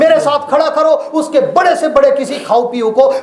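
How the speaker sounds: high, loud challenge to rivals; defiant preaching shout. Acted. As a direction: throw down the gauntlet. Challenging opponents. (विरोधियों को ललकारती ऊँची आवाज़)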